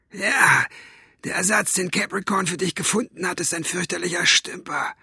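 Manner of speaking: high, rough villain's voice; rough cat-tongue voice. A narrator's reading. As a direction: rasp it like a cat's tongue. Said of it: "Das war wieder die Katzenstimme". (raue, hohe Katzenzungenstimme)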